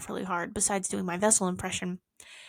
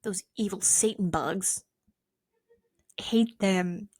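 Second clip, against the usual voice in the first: ordinary delivery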